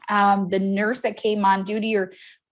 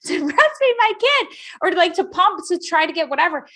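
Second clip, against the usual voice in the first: high-pitched, loud dismay at a monster machine; mock alarm; 12 semitones higher, 6 dB louder than usual. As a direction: exaggerate the horror in a high, rushed-up voice. Acted alarm at pump machine.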